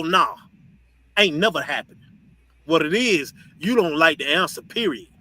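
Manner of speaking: ordinary talk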